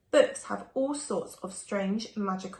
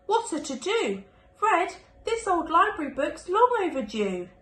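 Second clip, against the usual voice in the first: mother's voice; 8 semitones higher, 5 dB louder than usual. Acted. Mother scolding Red about library book.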